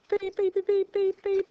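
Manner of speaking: high mock beeps back at the class; beeping back. Teacher beeps back at puzzled class, high playful beeps. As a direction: beep right back at them. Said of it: "I'm back at you".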